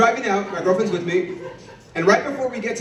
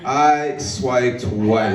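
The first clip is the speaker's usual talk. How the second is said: drawn-out repeat; 5 semitones lower, much slower than usual. Acted. Comic repeating punchline to the crowd, very slow drawl.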